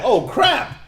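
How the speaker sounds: high, startled mock panic; alarmed realization. Acting the brothers facing the stern man, alarmed. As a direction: blurt it as if suddenly caught. Acts out the scared brothers' reaction.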